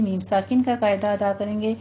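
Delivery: ordinary delivery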